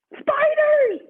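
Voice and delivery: very high, loud shriek; spiders shout. Acted. Reading to children, shouting the spider reveal. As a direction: yell the reveal.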